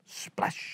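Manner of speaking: breathy whispered splash sound; soft whispered splash. A narrator's reading. Sound effect in story.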